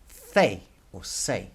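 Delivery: "C" said THEH, then "se" said SEH; lisped THEH for "C", or SEH; Spanish letter name. Shown as a demonstration. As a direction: give "C" both ways, THEH or SEH. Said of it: "how you say C in Spanish".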